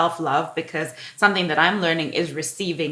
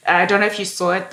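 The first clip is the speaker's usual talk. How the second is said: normal talking voice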